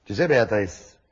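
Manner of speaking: low, deep seductive line; extremely deep bass voice. Shown as a demonstration. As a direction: croon it as low as possible. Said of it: "tu prends ta voix la plus grave".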